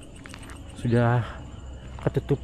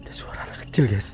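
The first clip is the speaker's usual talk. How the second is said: hushed, tense whisper; nervous night vlog whisper; 4 semitones lower, 5 dB quieter than usual. Real, not acted. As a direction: whisper it like something's out there. Hearing a child's voice at night. (bisikan tegang di malam hari)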